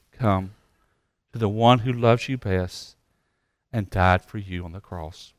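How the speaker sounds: low, soft pastoral appeal; hushed, low closing plea; altar-call invitation. Real, not acted. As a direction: gently urge them to come, with pauses between phrases.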